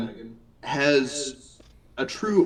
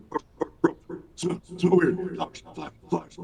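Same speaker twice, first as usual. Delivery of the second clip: dog voice; 6 semitones higher, faster than usual. Acted. GM voicing dog Ruff.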